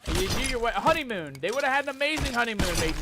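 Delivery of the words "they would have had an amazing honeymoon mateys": excited pirate. Acted. First take of repeated line.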